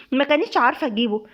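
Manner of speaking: usual speaking voice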